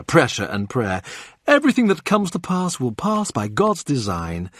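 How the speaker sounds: ordinary delivery, nothing marked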